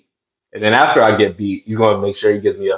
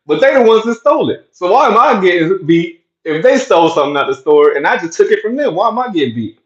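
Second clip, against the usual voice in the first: put-on high, whiny little-kid protest; six-year-old voice; 10 semitones higher, 3 dB louder than usual. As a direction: complain like a small boy who feels it's unfair. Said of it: "In my six-year-old voice".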